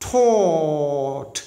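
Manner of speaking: taught with a long open o; taught pronounced. Shown as a demonstration. Teacher modelling taught for students. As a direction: say taught as "ot", held. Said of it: "từ này ta phát âm là".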